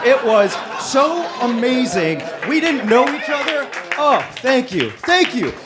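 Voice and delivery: high, loud gushing; ecstatic. Real, not acted. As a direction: shout it with pure joy. Euphoric about [name] movie.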